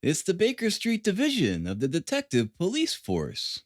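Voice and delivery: lightly voiced grave Holmes. A narrator's reading. Holmes gravely announcing the urchins, a touch grave. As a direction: say it with mock gravity. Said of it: "said my companion gravely".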